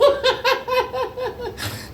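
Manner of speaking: shrieking high laugh; high squealing laugh. Retold or imitated. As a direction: let out a wild squealing laugh. Friend's wild laugh.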